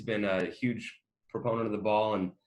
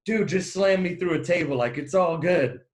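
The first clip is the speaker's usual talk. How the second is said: lightly acted fan plea, pitched up; eager Buffalo fan; 6 semitones higher, 4 dB louder than usual. Imitating fans asking to go through a table, lightly hyped-up. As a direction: egg him on like a mock rowdy fan. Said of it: "Buffalo fans coming up to you".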